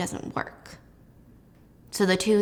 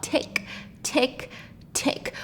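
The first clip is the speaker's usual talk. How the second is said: clock ticking; 5 semitones higher, 9 dB quieter, much slower than usual. Retold or imitated. Imitating the grandfather clock.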